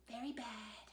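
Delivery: whispered very bad. A narrator's reading. Piggy whispers back to her brother. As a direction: breathe it as a cheeky secret. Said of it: "Said the piggy".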